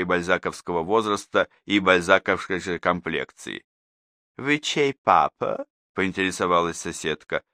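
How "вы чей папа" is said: lightly voiced higher woman's question, slow; a hint of a curious mother asking; mother's voice. A narrator's reading. Neighbour mother asks Danilov whose father he is, lightly voiced.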